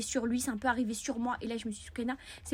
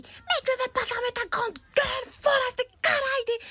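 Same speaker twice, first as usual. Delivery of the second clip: put-on much higher, louder, slow furious boy; angry boy; 12 semitones higher, 5 dB louder, slower than usual. Boy shouting at the girl, hammed-up rage. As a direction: bellow it like an outraged kid. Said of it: "il me dit".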